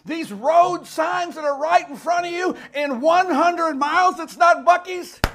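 high, loud pulpit delivery building to a shout; impassioned preaching. Real, not acted. Preacher announcing the rapture to the congregation, loud and impassioned. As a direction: build the sermon to a triumphant peak.

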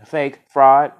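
narrated crowd jeer, punched out loud; jeering cry. A narrator's reading. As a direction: throw it out like an angry crowd. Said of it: "They laughed and jeered, crying".

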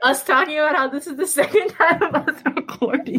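high, helpless giggly talk; real laughter pushing the voice up; laughing through words. Real, not acted. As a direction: talk on while cracking up. Both laughing about re-recording.

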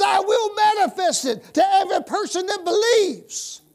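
a high, loud proclamation; shout. Acted. Preacher proclaiming Jesus' words, high loud shout. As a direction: shout it to the back row. Shouted quote, then repeated.

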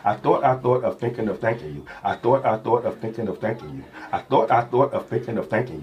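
quick, even rap-like delivery; smooth rhythmic rapper cadence; rapper flow. Acted. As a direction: rattle it off like a rapper's bars. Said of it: "that's the rapper in you".

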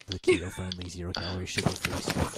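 low breathy ASMR whisper. Pretend. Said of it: "Here's some Gamer Supps A S M R".

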